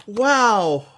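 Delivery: high, slow sarcastic wonder; mock admiration. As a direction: draw it out before the insult. Ruby fake praise then insult.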